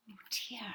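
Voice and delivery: sharp gasp then a whispered oh dear; gasp, then barely breathe the words; shocked gasp. A narrator's reading. Narrator reacting to the children's fate with a gasp.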